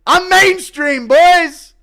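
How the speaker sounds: high, loud, stretched-out brag; squealing hype at the viewer count; giddy boast. Real, not acted. Streamer hits seventeen thousand viewers.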